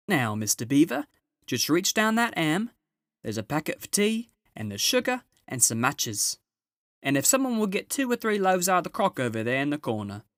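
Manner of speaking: a brisk, busy housewife giving orders; Mrs Beaver. Acted.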